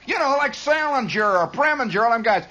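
put-on whiny kid. Kid pestering his father.

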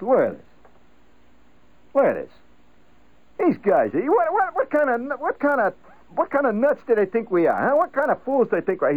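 father fuming over the newspaper; bugged father. Retold or imitated. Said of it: "my father would get this note in his voice".

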